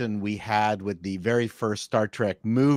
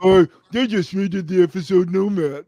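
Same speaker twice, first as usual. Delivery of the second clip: nerdy complaint; 6 semitones higher, 5 dB louder than usual. Retold or imitated. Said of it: "our nerdy objection".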